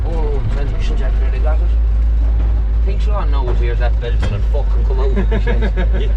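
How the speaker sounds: loud, tense warning; loud alarm. Real, not acted. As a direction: call it out loudly. Panic as the load falls.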